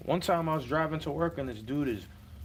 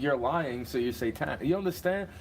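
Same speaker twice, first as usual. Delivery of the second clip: ordinary talk, nothing marked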